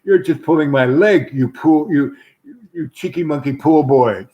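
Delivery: lightly voiced teasing woman; mock scolding. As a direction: tease him with mild mock outrage. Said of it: "she looks at me and goes".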